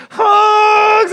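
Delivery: very high, loud cry of "Hugs!"; joyful shout. Retold or imitated. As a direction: call it out big, arms wide open. Director shouting after final take.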